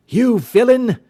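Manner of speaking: loud, high cry of accusation; furious shout. Acted. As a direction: hurl the accusation at him. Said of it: "said he".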